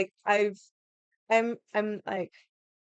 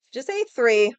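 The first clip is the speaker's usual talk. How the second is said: exasperated partner; 4 semitones higher, 7 dB louder than usual. Retold or imitated. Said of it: "my partner is just like".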